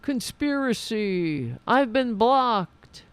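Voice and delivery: slow, dopey mock voice; mocking slow drawl. Mocking Rockfin posters with a slow, dopey drawl. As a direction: drag the words like a conspiracy crank. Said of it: "there's, like".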